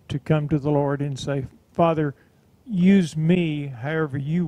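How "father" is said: ordinary talk, nothing marked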